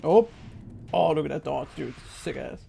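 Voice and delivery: ordinary talk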